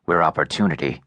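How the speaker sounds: かなり低く抑えた男の声; 喉の奥から低く沈んだ声で; low voice. A narrator's reading. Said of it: "said Low Voice".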